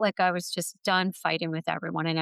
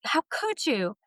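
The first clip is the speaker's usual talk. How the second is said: scolding inner voice; 4 semitones higher, slower than usual. Retold or imitated. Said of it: "my head's scolding me".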